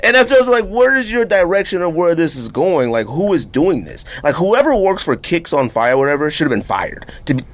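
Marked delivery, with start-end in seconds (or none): 0.0-5.0 exasperated. Real, not acted. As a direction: throw the question up in disbelief. Exasperated rant.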